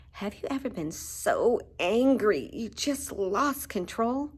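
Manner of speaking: usual speaking voice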